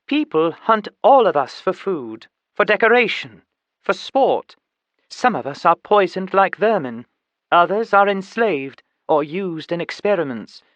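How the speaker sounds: put-on high, emphatic lecturing chimp with educated accent; educated chimpanzee activist.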